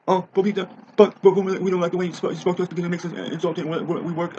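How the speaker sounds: high, stammering whiny voice; mocking whiny kid. Ranter mocks the kids' protest in a high whine. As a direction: parody them stuttering in a high whine. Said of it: "mocking the kids' protest".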